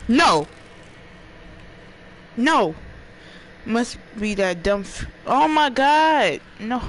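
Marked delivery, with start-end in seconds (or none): 0.1-2.7 scared screams. Real, not acted. Jump scare in game. 5.3-6.8 high, loud, slow drawn-out cry of fright; startled horror shout; panicked cry. Real, not acted. Startled by game.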